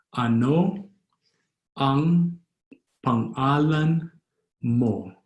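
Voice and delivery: long pauses between drawn-out words; slow, word by word. Shown as a demonstration. Teacher repeating the name question for learners, slowly word by word. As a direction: sound out each word of "Ano ang pangalan mo" separately. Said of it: "Or fast, as a Filipino would".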